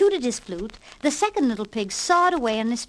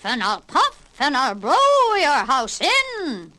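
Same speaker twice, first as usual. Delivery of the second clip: slow, louder menacing wolf voice; roaring wolf; 3 semitones higher, 4 dB louder, slower than usual. Acted. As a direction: threaten the pig, drawn out. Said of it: "roared the wolf".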